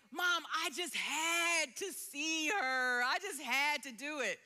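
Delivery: mock-tearful son's wail, words stretched out; breaking-down plea. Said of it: "he kinda broke down".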